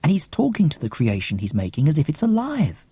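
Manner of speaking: ordinary delivery, nothing marked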